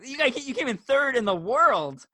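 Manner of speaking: high-pitched, amazed protest; re-enacted astonished fan; incredulous. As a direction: insist in disbelief at their modesty. Retelling his amazement at the racer.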